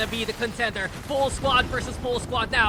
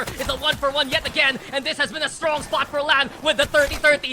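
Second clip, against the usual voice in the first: shouted, rising play-by-play; hype esports caster; 6 semitones higher, 8 dB louder than usual. Real, not acted. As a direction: build to a hype yell. Caster hype on final fight.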